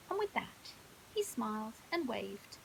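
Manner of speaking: usual speaking voice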